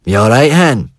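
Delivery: lightly voiced big brothers; brothers' voices. A narrator's reading. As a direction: brothers call to their sister from the street, mock gruff. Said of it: "Two male voices say".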